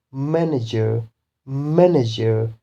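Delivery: MAN-ager, long stressed first syllable in manager. Shown as a demonstration. Teacher shows the ER rule, stress on MAN in manager. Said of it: "stress will be on first syllable".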